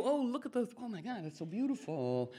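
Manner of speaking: botany wonder. Retold or imitated. Eddie Izzard bit phaser stunned into botany, mock gushing. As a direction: gush like someone charmed by a flower. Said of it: "So Eddie Izzard says".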